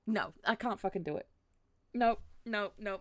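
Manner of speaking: loud, exasperated refusal; exasperated giving up. Real, not acted. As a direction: blurt it out in defeat, loudly. Gives up on the accent.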